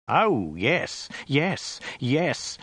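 high, swooping exultant yeses; fierce pride. A narrator's reading. Young general answering his father, fiercely proud. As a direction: exclaim each yes with proud relish. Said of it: "exclaimed Ogdai with fierce pride".

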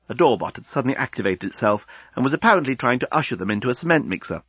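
plain reading voice

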